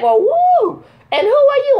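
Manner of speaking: high, hammed-up cooing onlooker; admiring crowd. Crowd eyeing her on stage, imitated admiration. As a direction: coo it up high like a flirty audience.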